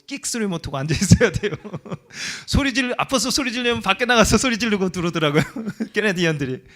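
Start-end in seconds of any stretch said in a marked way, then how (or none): 2.5-6.6 high and loud, bursting with laughter; laughing loudly. Real, not acted. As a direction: laugh through the whole line. Laughing story of Canadian patients. (웃음 터뜨리며 크게)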